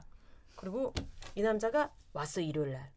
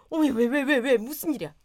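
alarmed mother-in-law; 2 semitones higher, 8 dB louder than usual. Retold or imitated.

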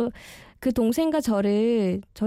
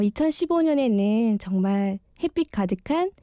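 ordinary talk

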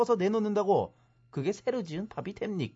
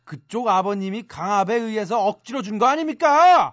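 loud, forceful accusation; indignant, booming rebuttal; raised voice; 9 dB louder than usual. Acted. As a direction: raise your voice to challenge their story. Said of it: "왜 이렇게 소리를 언성을 높이시고".

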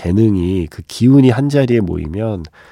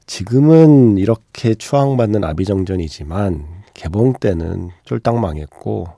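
ordinary talk

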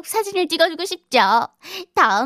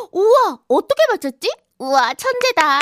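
amazed gasp; 8 semitones higher than usual. Acted. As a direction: act amazed for the kids. Host amazed at correct answer.

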